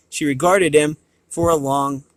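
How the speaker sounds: ordinary delivery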